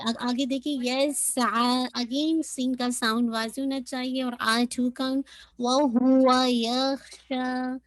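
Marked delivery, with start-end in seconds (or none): 5.6-7.8 यख्शा with heavy खा and shीन held two counts; slow recitation. Shown as a demonstration. Teacher modelling heavy खा for student. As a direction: recite व हुआ यख्शा slowly. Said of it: "खा को heavy".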